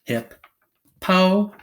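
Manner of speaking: "Hip, po" in two separated beats; syllable by syllable. Shown as a demonstration. Teacher putting syllables together, "Hip, po" said apart. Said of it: "Put 'em together".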